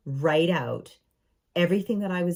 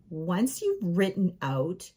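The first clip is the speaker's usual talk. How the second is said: ordinary delivery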